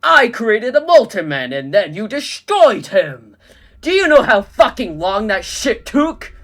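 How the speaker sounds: loud, furious rant; ranting Mysterio. Acted. Mysterio ranting about his ruined masterpiece. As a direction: vent your outrage.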